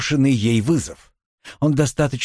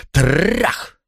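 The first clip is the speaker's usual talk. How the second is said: higher rolled-r crash, rising; crashing blow; 5 semitones higher than usual. A narrator's reading. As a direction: voice the second bang on a door. Narrator imitates blows on door.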